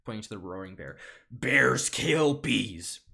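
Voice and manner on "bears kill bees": very slow, loud declaration; dramatic courtroom cry. Acted. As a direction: stretch each word as a booming accusation. Bee points at roaring bear.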